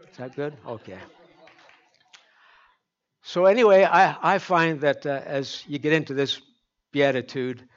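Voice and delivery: usual speaking voice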